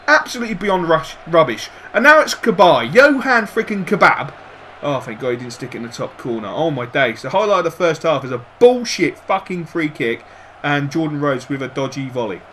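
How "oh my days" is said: ordinary delivery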